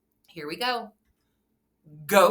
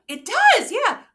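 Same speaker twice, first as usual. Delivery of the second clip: excited gasp; 10 dB louder, slower than usual. Acted. Gasping excited praise.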